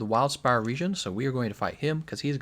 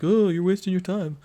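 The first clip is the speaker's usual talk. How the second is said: mock critic; 6 semitones higher, 5 dB louder than usual. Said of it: "and you're like".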